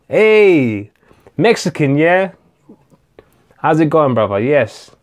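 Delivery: ordinary talk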